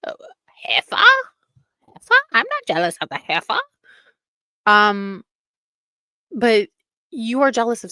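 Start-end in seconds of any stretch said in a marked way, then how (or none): 0.1-3.6 squeaky mock voice, Heifer? whispered high; hammed-up impression of a scornful woman; mocking Priscilla. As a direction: mimic her scornful denial. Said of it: "And Priscilla says all the time".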